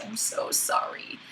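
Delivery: whispered apology. Real, not acted. Apologizing to viewers for the messy video, whispered. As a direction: breathe the apology.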